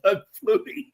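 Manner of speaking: high-pitched retold outburst; imitated sleepy yell. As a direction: imitate someone waking with a cry. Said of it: "woke up and yelled".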